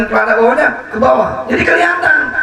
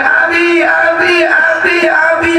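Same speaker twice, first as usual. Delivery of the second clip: higher-pitched calls for father; imitated child crying "daddy"; child calling out; 7 semitones higher, slower than usual. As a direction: call out repeatedly like a child to its father. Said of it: "Sehingga anak itu manggil".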